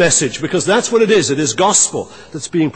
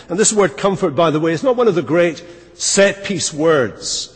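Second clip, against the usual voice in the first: ordinary delivery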